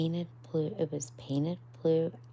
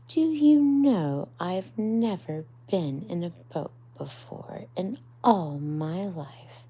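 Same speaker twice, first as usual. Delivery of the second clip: much louder, eager disbelief; excited Mole; 3 semitones higher, 7 dB louder than usual. Acted. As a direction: blurt it out in wonder. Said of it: "said the rat".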